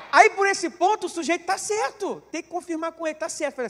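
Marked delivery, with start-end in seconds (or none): none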